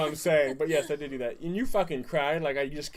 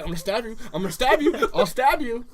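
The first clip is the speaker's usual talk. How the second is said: put-on high, loud childish threats, building; mock threat; 7 semitones higher, 5 dB louder than usual. Imitating six-year-old brother with a knife, high mock threat. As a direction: threaten like a worked-up little kid.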